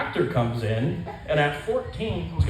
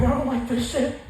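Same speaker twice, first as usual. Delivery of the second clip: squeaky mock protest, pitched way up; high pained whine; 11 semitones higher than usual. Teen on the table complaining, high mock whine. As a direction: whine it like it hurts. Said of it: "I'm like".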